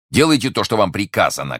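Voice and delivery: loud, high command; shouted order. A narrator's reading. Commissioner orders the jailers about, shouting. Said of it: "крикнул комиссар тюремщикам".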